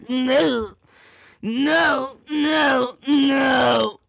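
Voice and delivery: high, loud repeated no ending in a shout; overwhelmed no. Real, not acted. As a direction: protest in mock disbelief. Overwhelmed protest.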